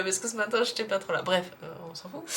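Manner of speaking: ordinary talk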